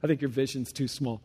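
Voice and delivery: low, quiet quoted remark; lightly voiced missionary friend; quoting a friend, low. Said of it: "He's like".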